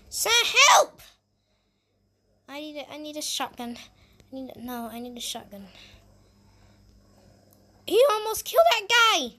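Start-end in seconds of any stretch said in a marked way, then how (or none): 0.1-1.0 high, loud distress call; shouted plea. Acted. Zombie attack, calling for help. 7.9-9.3 high, loud exclamation of shock; alarmed shout. Acted. Alarm during the zombie fight.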